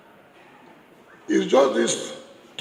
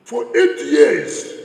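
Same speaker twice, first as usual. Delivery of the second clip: For eight years, slow and higher, louder; slow emphatic stress; 6 semitones higher, 6 dB louder, much slower than usual. Real, not acted. Speaker to crowd about the governor years, slow and stressed.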